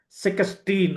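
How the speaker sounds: "సిక్స్టీన్" said as se-ka-steen; Egyptian pronunciation. Shown as a demonstration. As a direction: split the ks in "సిక్స్టీన్" with an a. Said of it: "అని చెప్తారు".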